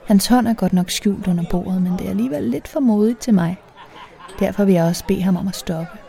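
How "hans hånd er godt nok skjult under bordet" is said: ordinary delivery, nothing marked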